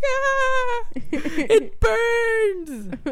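high-pitched, hammed-up cry of pain; mock screaming. Washing off the spit at the fountain, mock screaming. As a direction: squeal as if splashed with acid. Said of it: "Y yo empecé a gritar".

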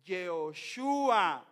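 "Yehoshua" drawn out slowly, stress on SHU; slow, deliberate "Yehoshua" with a long "shu"; Hebrew pronunciation. Shown as a demonstration. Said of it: "Jesús viene del hebreo".

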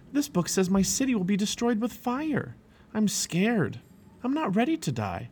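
high, anxious storybook voice; scared. A narrator's reading. Says he is scared.

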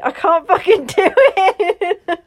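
frustrated laughing outburst. Real, not acted. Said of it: "Don't make me do this".

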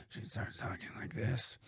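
unvoiced, breathy whisper. Shown as a demonstration. Imitating the woman's breathy phone voice. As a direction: breathe the words out with no voice. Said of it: "Just a little breathy".

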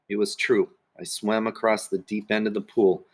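ordinary delivery

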